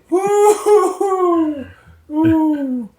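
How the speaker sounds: woo-hoo exhale. Real, not acted. Recovering from laughing fit.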